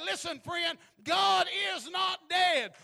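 loud, high and slow, hammering God is not dead; shouted proclamation. Acted. Preacher declaring to the congregation, full-force shout. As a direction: proclaim it to the back row.

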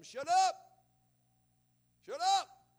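loud, high angry yell; shouting shut up. Retold or imitated. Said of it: "They tell them".

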